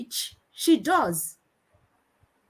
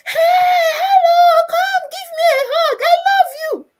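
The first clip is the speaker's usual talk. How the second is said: very high, loud squealing shout; excited child shouting; 9 dB louder than usual. Retold or imitated. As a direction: holler to a best friend far away. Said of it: "he starts shouting".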